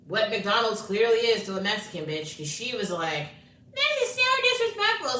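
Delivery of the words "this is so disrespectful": mock granny voice. Mocking the Mexican granny.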